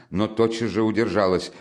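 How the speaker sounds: plain reading voice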